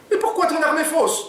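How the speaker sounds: very high, loud, alarmed Oumar voice; panicked question. Acted. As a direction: blurt it out in panic. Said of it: "demande-t-il".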